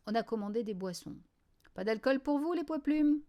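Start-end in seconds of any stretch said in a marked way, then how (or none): 1.7-3.2 higher, louder put-down from a barman; mildly humiliating. A narrator's reading. Barman belittling the young bowlers, mildly humiliating. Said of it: "sans doute pour nous humilier".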